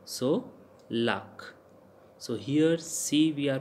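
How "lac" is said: "lack" said as "lahk". Shown as a demonstration. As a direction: give "lack" an open ah vowel. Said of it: "A pronounced like A".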